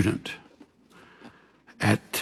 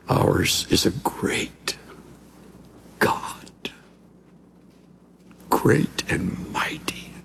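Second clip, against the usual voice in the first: slow, reverent near-whisper; low, hushed declaration with long pauses; hushed awe; 3 semitones lower, 4 dB quieter, slower than usual. Real, not acted. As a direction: let each word fall softly, in worship. Preacher's awed climax before prayer.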